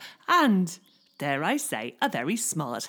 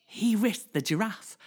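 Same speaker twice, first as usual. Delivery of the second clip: ordinary delivery, nothing marked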